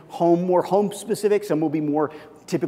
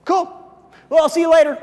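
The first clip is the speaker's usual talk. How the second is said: high, loud breezy goodbye; brush-off; 12 semitones higher, 10 dB louder, faster than usual. Pretend. Coworker dismissing the chat, a high breezy brush-off. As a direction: cut the talk short cheerfully. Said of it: "If they go".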